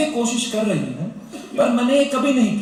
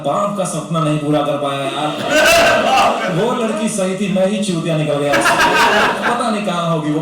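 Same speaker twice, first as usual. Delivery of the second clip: mock inner lament; 5 semitones lower, 4 dB louder than usual. Inner voice of depressed man.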